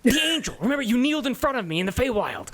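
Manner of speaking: high, quick angel voice; light voice of a divine messenger. Acted.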